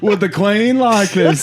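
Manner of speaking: slow, high British voice breaking into laughter; British accent. Acted. British mortician appealing to the Queen, slow high British accent. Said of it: "accent-wise".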